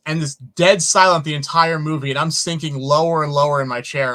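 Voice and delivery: ordinary talk, nothing marked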